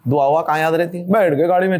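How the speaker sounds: ordinary delivery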